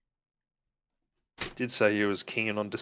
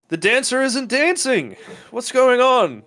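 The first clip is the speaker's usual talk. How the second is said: high, loud alarmed cry; a flustered host calling out in alarm; 13 semitones higher, 7 dB louder than usual. Acted. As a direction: NPC spotting the idle dancer, raised alarmed voice. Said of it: "and he goes like".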